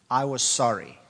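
usual speaking voice

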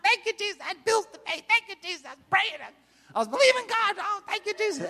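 high, loud, overjoyed sobbing praise; joyful old lady. Retold or imitated. Old lady in the parking lot praising Jesus, high and tearful. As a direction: cry out thanks for paid bills.

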